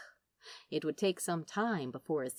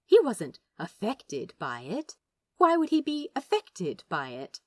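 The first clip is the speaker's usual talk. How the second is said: louder, rising sarcastic fury; bitter rant; 3 semitones higher, 7 dB louder than usual. A narrator's reading. Crowley raging inwardly about killing his friend, loud sarcastic fury. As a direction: build from sneer to bitter outburst.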